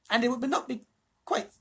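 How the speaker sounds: high, piping mouse. A narrator's reading. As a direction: say it in the little mouse's lofty voice. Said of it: "sorry, this is me speaking".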